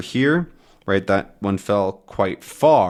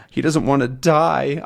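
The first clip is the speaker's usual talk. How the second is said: ordinary talk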